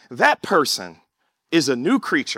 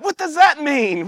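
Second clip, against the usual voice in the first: confused relative; 9 semitones higher, 4 dB louder than usual. Retold or imitated.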